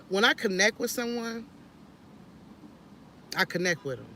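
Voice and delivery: ordinary talk, nothing marked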